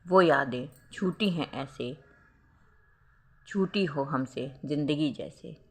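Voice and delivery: slow, lingering recital of a heartbreak verse; poem recital. A narrator's reading. Narrator recites the heroine's heartbroken verse, slow and sad. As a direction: let each line hang, slow and wistful. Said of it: "वह मन ही मन सोचने लगी".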